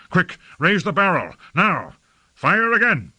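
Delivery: feverish, high-pitched orders; urgent shouted firing orders; urgent command. A narrator's reading. Lord gives feverish firing orders.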